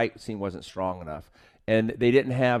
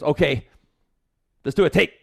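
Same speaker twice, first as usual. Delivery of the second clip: imitated director's call; 5 semitones higher, 6 dB louder than usual. Director voiced calling for a take.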